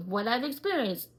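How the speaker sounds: normal talking voice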